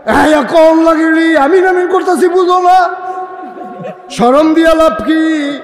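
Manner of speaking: high, very loud retort; hammed-up marital scolding; acted quarrelling shout. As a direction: yell back indignantly, pitched high. Acted quarrel during the prayer.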